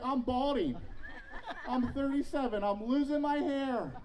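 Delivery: high, loud, laughing alarm, slowing at the end; laughing mock panic. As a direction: pitch it up and laugh through mock horror. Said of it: "I'm like".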